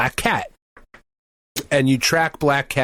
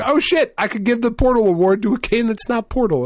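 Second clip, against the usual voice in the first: excited; 8 semitones higher than usual. Retold or imitated. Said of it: "And I was like".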